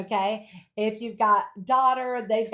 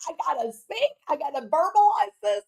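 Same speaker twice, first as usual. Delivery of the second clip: mocking a teen; 8 semitones higher, 5 dB louder than usual. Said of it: "they're like".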